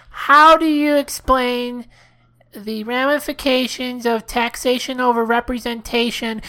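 put-on halting phone-in question; earnest caller. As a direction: labour through a long question in character.

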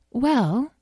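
high, lilting, thoughtful pony; musing pony. A narrator's reading. Starfire considering another answer for Azrael, musing. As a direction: draw the word up as you ponder. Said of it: "mused Starfire".

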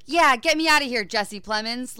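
loud, high-pitched retold yell; hammed-up urgent order, starting high; mock angry demand. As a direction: act out a tied-up man's impatient demand. Tied-up villain's line, acted.

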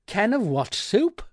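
loud, high query from a deaf shop man; shouted question. A narrator's reading. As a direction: shout it back, not having heard. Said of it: "he shouted".

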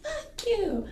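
high-pitched indignant retort; imitated indignant friend. Friend reacting to a threat, high imitated indignation. Friend's furious reply acted.

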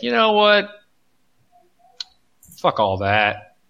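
hammed-up cocky Russian state; mock Russia voice. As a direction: play Russia as a brash upstart brushing everyone off.

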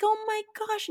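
very high, softened mock gasp of joy; retold overjoyed amazement. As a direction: imitate a mother floored with relief. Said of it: "she's just overjoyed".

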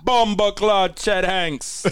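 slow Jamaican patois exclamation; rasta speech. Pretend. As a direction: drawl it out in a Rasta accent. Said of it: "you gotta do the Rasta voice".

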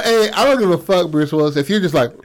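normal talking voice

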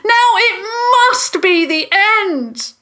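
impassioned yell; climactic shout. A narrator's reading. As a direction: shout it as a final command. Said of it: "Jasmine shouted as he finished".

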